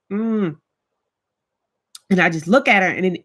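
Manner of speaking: ordinary talk, nothing marked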